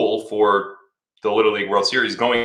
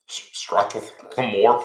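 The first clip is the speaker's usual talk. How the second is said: very slow, halting "Strathmore" with a lift at the end; puzzled sounding-out; much slower than usual. Shown as a demonstration. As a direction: stumble through the word like it makes no sense. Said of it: "what is that?".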